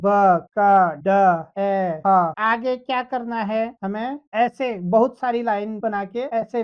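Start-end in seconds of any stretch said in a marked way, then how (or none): 0.0-2.3 ब, क, ड, ए, ह each called out loud and separate; loud sound drill. Shown as a demonstration. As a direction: read the letter sounds out loud for the class. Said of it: "उनका प्रयोग कर हम इनको पढ़ना आरंभ करेंगे।". (कक्षा के लिए ज़ोर से ध्वनि पढ़ना)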